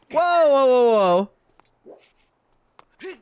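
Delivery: loud rising whoas, real surprise; startled whoa. Real, not acted. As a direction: blurt it out in real shock. Shocked at game event.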